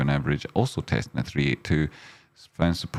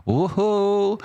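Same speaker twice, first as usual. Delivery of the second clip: excited whoop; 14 semitones higher, 3 dB louder than usual. Real, not acted. Reacting to chart on stream.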